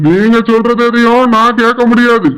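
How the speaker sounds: a lightly voiced stern elder brother-in-law; a hint of a stern older brother. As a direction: scold him as the wife's protective brother. Brother-in-law gives orders.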